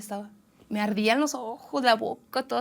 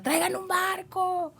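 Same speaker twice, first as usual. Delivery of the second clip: high, drawn-out cry for help; long wailing call, not at full volume; retold shout; 9 semitones higher, slower than usual. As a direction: imitate calling out desperately across the water. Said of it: "Y gritaba, güey".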